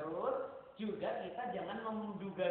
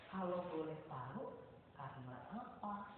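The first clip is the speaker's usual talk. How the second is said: much softer, tender probing question; soft gentle asking; 5 semitones lower, 10 dB quieter than usual. Shown as a demonstration. Teacher gently asks the child why: soft and careful. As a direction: ask quietly, almost under the breath. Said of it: "izin lagi".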